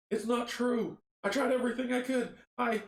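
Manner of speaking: high, urgent protest; crying out. Acted. Samuel defends himself to the dead crew, crying out. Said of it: "Samuel cries".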